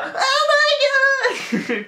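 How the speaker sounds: drawn-out high wailing cry; a hammed-up, very slow childish wail; mock wail. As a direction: howl like an upset little kid. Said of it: "Crying like a little bitch".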